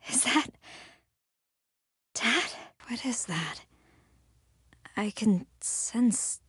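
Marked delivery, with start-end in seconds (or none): 0.1-3.6 hushed wary whisper. Acted. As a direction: whisper it, unsure. Something strange nearby.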